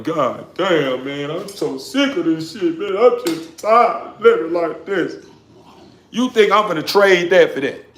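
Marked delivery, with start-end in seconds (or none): 0.0-5.2 slow, tired, fed-up groan; weary complaint. Retold or imitated. A rich man complaining once the cameras go off, slow and weary. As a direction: drag it out like you're worn out. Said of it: "I'm like".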